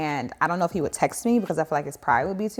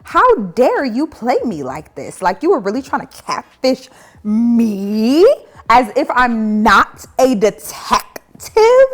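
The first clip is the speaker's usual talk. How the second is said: enraged; 4 semitones higher, 7 dB louder, slower than usual. Retold or imitated.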